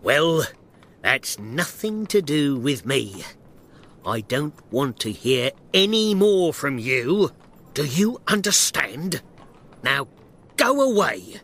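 loud, indignant brush-off; angry dismissal. Acted. Shopkeeper ordering the little man away from his shop, angry. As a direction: send him packing angrily.

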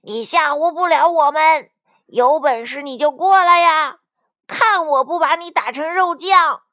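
loud, high defiant shout; defiant taunt. Acted. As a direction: dare them to come and fight. Defiant threat to an enemy. (挑衅地高声叫喊)

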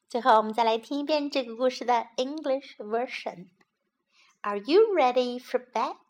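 usual speaking voice

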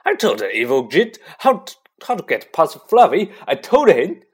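loud, high wail of remorse; grief and remorse. A narrator's reading. Hagrid confesses to Harry in floods of tears, remorseful. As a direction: wail it out, building in pitch and volume. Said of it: "shaking with grief and remorse".

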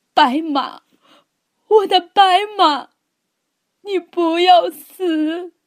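high, loud, pleading wail; anguished cry. A narrator's reading. Boy begging his dying horse to live, anguished. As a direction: cry out in grief, stretching the last words.

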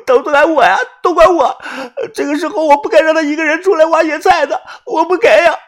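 high, loud distraught wail; anguished self-blame. A narrator's reading. Yuanbao blames himself for the woman's disappearance, anguished wailing. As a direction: lament it in a high, hurried cry. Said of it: "呢喃地说道".

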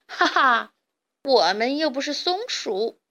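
short laugh, then mocking reply; laughing scoff. A narrator's reading. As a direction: laugh off the silly suggestion. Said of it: "强盗巴巴笑道".

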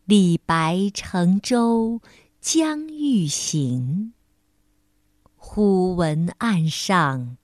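slow, clear recitation voice; poem recitation. Acted. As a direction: recite each line slowly to children.

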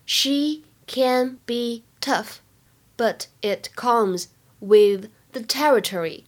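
slow, word-by-word teaching read; slow model reading. Shown as a demonstration. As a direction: say each word separately, drawn out for learners. (一字一顿地慢速示范朗读)

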